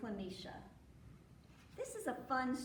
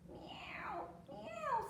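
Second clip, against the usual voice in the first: thin, whispery high meows; rabbit meowing; 13 semitones higher than usual. A narrator's reading. Storyteller as the rabbit who caught the meow, high whispery meowing. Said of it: "said the rabbit".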